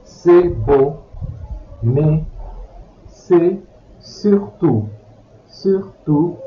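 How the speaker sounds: usual speaking voice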